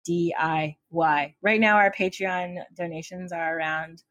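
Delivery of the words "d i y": "D I Y" spelled out slowly, each letter separate; slow emphatic D I Y. Shown as a demonstration. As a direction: drag out "D I Y" letter by letter. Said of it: "when I say D I Y, I really mean".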